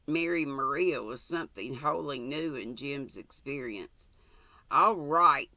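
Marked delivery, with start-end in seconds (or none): 4.7-5.5 louder defiant snap. Acted. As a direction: throw it out in defiance. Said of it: "he said defiantly".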